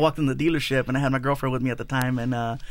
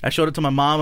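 ordinary talk

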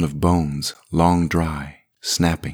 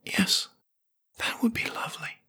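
faint breathy whisper, high; nearly inaudible whisper; 9 dB quieter than usual. A narrator's reading. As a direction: barely breathe it out. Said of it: "her voice nearly inaudible".

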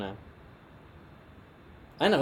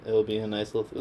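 usual speaking voice

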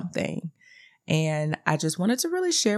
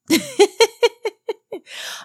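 high rapid giggle; a real high-pitched run of giggles; 3 dB louder than usual. Real, not acted. Speaker amused by her mother's fear of Satan, high giggle. Laughs after blaming Satan.

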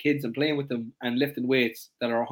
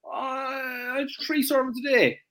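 much higher, unsure answer; unsure client; 10 semitones higher, much slower than usual. Retold or imitated. Coach imitates client guessing a protein target, high and unsure. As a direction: fumble the number like a guessing client.

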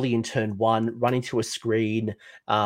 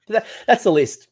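emphatic; 9 semitones higher, 6 dB louder than usual. Real, not acted. Emphatic reveal of the list.

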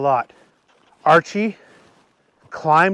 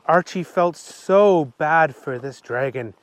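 plain reading voice

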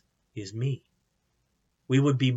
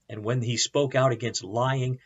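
ordinary talk

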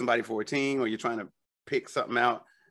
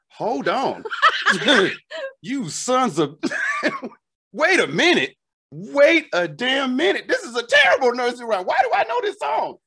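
high, loud and laughing in disbelief; wait, what did I just sing; shocked realization; 12 semitones higher, 5 dB louder than usual. Retold or imitated.

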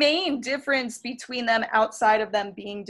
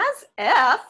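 put-on higher, louder Valley Girl accent; 5 semitones higher, 4 dB louder than usual. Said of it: "it's a Valley Girl accent".

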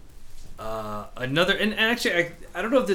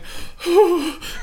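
emotional gasp; 6 dB louder than usual. Retold or imitated. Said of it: "the tone of her voice".

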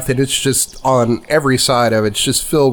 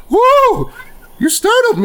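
put-on startled old lady. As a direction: jump out of your skin as a frail old lady.

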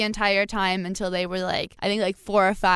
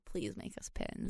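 much lower and softer aside; retold plea; 11 semitones lower, 18 dB quieter than usual. Guest retelling how she asked for pins, low quiet plea. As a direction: drop low and quiet for a small mock plea. Retold pleading request to pin maker.

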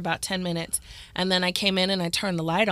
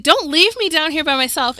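higher, louder frightened begging; panicked plea; 9 semitones higher, 9 dB louder than usual. Retold or imitated. Left in the dark basement, higher louder panicked plea. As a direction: beg not to be left alone. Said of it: "And I was like".

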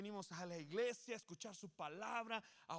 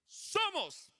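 much higher, much louder shout of Somos; battle-cry shout; 10 semitones higher, 10 dB louder than usual. Shown as a demonstration. Pastor rallies the congregation like soldiers, shouting. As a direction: yell it like charging into war. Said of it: "última vez".

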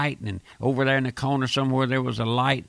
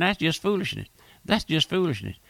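usual speaking voice

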